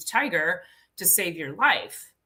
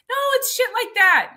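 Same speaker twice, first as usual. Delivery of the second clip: emphatic exclamation; 5 dB louder than usual. Real, not acted.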